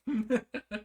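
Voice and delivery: short high-pitched giggle. Real, not acted. Laughing at goofy character faces.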